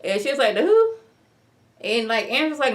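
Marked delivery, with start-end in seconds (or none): 0.5-0.9 high, loud mock disbelief; baffled. Woman asked about the Rakaduchi, baffled imitated reply. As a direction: shoot the question way up in imitated confusion.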